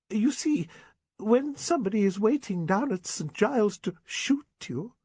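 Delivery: high, pleading spinster voice, slowing at the end; a wounded old spinster reproaching him; Miss Prentice. Acted. Miss Prentice laments her injury.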